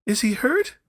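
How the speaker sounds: mother demanding news of her son, frantic. A narrator's reading. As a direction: blurt it out in fear. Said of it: "demanded the mother".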